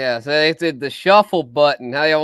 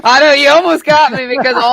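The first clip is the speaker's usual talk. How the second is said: laughing; 11 semitones higher, 7 dB louder than usual. Real, not acted. Laughing at being caught.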